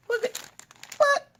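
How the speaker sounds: high-pitched cutesy squeal; squealing excitement. Real, not acted. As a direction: squeal over something tiny and cute. Gushing over the tiny console.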